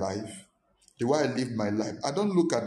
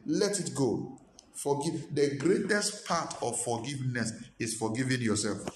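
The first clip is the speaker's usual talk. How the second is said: ordinary delivery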